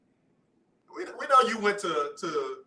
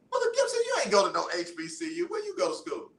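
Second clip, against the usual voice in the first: much higher, fast teasing challenge; playful accusation; 11 semitones higher, faster than usual. Real, not acted. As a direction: call him out laughing. Mock accusing caller.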